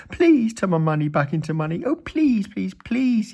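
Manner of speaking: high, pleading whine; Mister Mean begging the wizard for his money. A narrator's reading. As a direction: beg desperately. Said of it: "he begged".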